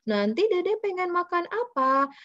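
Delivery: put-on high, sing-song voice for a small child; parent to toddler. Shown as a demonstration. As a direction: coax a toddler over a picture. Talking to a toddler, demo.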